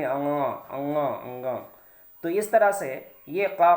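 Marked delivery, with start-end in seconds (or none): 0.0-1.7 अंगा hummed through the nose; nasal nga. Shown as a demonstration. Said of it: "नाक से आवाज़ निकालनी".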